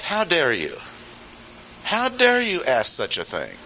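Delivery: high-pitched, offended rebuke; indignant revulsion. Acted. Preacher voicing Paul's first response to the question, indignant. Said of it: "first response is colored with the idea of".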